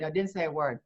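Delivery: usual speaking voice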